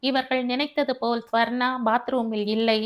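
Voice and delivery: usual speaking voice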